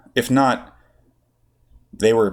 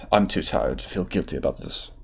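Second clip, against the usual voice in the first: lower, softer self-talk; to himself; 5 semitones lower, 6 dB quieter than usual. Acted. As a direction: murmur it to yourself, tired. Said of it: "Obi-Wan told himself".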